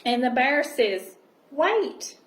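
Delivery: gentle storybook reading voice; cosy read-aloud storytelling; reading to children. Acted. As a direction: read it like a bedtime picture book. Reading picture book aloud.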